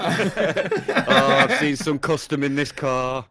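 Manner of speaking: high, loud, laughing through it; laughing mock commenter. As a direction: crack up while quoting. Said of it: "comment on your video and go".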